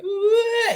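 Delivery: put-on high-pitched wail of a child breaking down; imitated breakdown wail. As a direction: imitate a small boy bursting into tears. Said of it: "he just broke".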